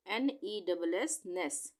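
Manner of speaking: ordinary talk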